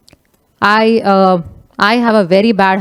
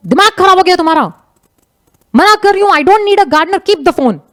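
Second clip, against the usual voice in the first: higher-pitched irritated retort; a little angry; 7 semitones higher, much faster than usual. Retold or imitated. Madam fed up with a persistent caller, annoyed. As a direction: imitate an exasperated madam telling someone off. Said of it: "ma'am got little angry".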